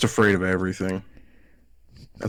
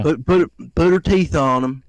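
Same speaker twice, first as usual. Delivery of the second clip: higher, louder mock-Southern aunt; fake Southern accent; 5 semitones higher, 5 dB louder than usual. Nephew repeating his aunt's accusation, fake Southern accent. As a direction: put on an exaggerated Southern voice. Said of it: "puts on a fake accent".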